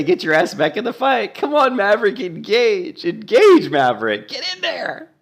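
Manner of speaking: high, urgent mock Top Gun yell; imitating the healer shouting at Maverick. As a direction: egg him on like a frantic wingman. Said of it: "She's probably, like, killing him to say".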